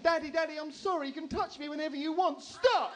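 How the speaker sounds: high, loud wailing little-girl impression; hammed-up tearful daughter begging; mock crying child. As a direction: plead like a distraught child, building to a shout. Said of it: "she's crying".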